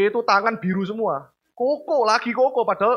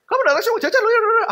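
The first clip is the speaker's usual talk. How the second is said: very high, louder mock mum; nagging mother; 7 dB louder, faster than usual. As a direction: nag about pocket money. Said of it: "Tapi kalau mama". (ibu mengomel soal uang jajan, nada tinggi)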